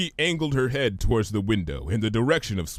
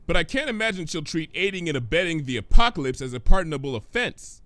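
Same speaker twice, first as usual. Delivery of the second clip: higher, pointed questioning mare; Twilight's voice; 7 semitones higher than usual. Acted. Twilight demanding answers from Pinky, higher voice.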